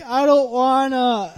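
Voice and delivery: high, slow, laughing whine; whiny refusal. Retold or imitated. Waitress whining at the boss, slow high laughing whine. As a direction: drag it out like a sulking kid. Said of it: "she's like".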